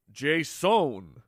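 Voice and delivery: stretched big O in "Jason"; "Jason" with a drawn-out O; flair on the O. Shown as a demonstration. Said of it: "so I had to put some flair upon it".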